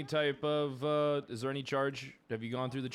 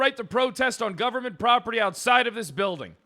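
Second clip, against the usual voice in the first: angry shouting; 8 semitones higher, 8 dB louder than usual. Real, not acted. Cop yelling at protester.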